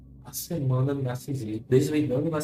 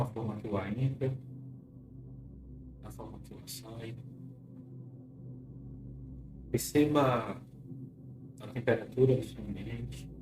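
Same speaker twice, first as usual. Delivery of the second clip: guided hypnosis; 2 semitones lower, 9 dB quieter than usual. Acted. Leads a clinical hypnosis session.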